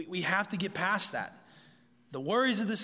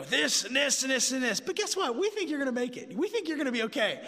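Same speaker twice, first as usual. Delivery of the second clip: mock reassuring writer; 6 semitones higher than usual. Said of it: "is like".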